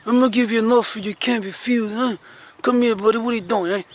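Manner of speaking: hammed-up gangster leaning on a buddy; mobster impression. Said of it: "let me show you guys an impression".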